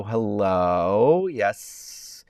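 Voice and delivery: normal talking voice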